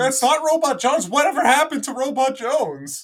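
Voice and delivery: high, loud cartoon robot voice. Loud cartoon theme imitation.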